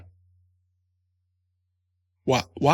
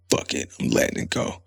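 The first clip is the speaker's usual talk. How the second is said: muttered resolve; 5 dB quieter than usual. Retold or imitated. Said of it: "I was like".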